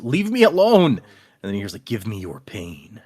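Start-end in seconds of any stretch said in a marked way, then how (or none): 0.0-0.9 mild mock scream, higher; retold scream. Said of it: "he, he screams". 1.8-3.0 low, slow, hushed menace; voice in the head. Retold or imitated. Voice he hears in his head.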